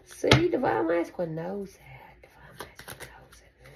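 breathy secret whisper. Acted. Telling the viewer what Divine Masculine knows, whispered. Confiding the partner's feelings in whisper.

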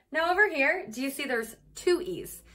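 ordinary delivery

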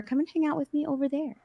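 ordinary talk

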